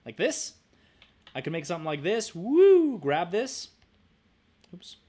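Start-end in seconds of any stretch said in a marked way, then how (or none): 2.3-3.0 high, loud playful whoop; excited woo. Real, not acted. Teacher cheering at her own drawing tool, loud and high. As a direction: cheer as the shape snaps into place. Excited cry while dragging shape.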